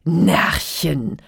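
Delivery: loud, dismissive giant; amused giant. A narrator's reading. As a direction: scoff loudly as the giant. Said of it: "antwortete der Riese und lachte". (laut spottender Riese)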